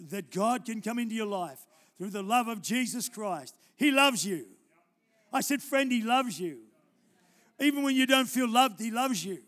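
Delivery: loud, pressing preacher's appeal; altar call. Acted. Preacher closing the sermon with a loud appeal. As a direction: build the appeal to the room.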